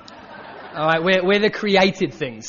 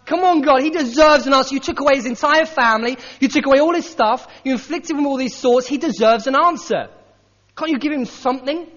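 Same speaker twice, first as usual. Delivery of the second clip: higher, louder, demanding; indignant protest; 5 semitones higher, 5 dB louder than usual. Retold or imitated. Preacher voicing our complaint to God, higher and louder. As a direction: plead hotly on Job's behalf. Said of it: "We're like".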